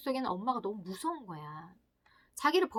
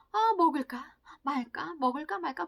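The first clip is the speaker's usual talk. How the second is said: torn, dithering ego; 5 semitones higher, 3 dB louder than usual. Acted. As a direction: weigh it aloud, can't decide. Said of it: "굉장히 갈등하는".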